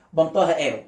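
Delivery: normal talking voice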